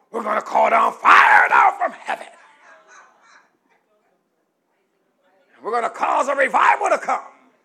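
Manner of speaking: loud hammed-up boast of fire from heaven; mock zealous shout. Preacher mimicking overconfident intercessors, shouting. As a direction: shout it like overeager prayer warriors. Mocking all-night prayer boasts.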